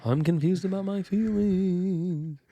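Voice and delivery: slow, high ghostly moan; unearthly voice. Pretend. Spooky ghost voice game.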